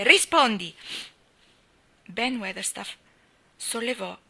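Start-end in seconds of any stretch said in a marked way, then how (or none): 0.0-0.7 loud sharp command; a loud demand for an answer; imperious order. A narrator's reading. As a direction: bark the order at the old man. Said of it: "ancora più imperiosamente".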